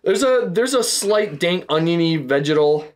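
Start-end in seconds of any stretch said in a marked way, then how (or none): none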